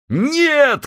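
high shouted no; chorus shout. Acted. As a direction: yell it as a chorus. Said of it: "крикнули крестоносцы любви".

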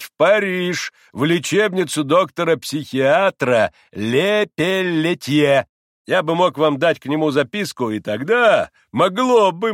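loud, slow, drawn-out pronouncement; pompous doctor. A narrator's reading. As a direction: lecture grandly, stretching the key words. Pompous doctor prescribes impossible travels.

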